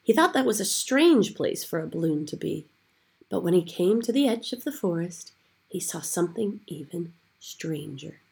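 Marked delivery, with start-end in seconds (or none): none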